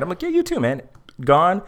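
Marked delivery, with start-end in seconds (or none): 0.2-0.8 breezy reply. Retold or imitated. His own casual reply.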